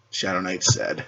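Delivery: ordinary delivery, nothing marked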